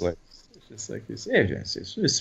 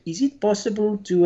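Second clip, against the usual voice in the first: high, imitated French-accented question; French tourist accent; 12 semitones higher than usual. French tourist making a request, high French accent. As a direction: mimic a French tourist politely asking. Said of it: "they always say".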